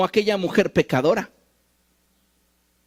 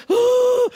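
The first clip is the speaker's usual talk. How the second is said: mock sob; 4 dB louder than usual. Crying alone at home.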